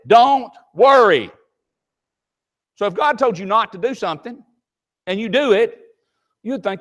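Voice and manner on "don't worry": slow, high and loud divine command; God's voice. Retold or imitated. Preacher voicing God, slow high loud command. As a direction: speak it slowly as the Lord, commanding. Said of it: "the Lord said".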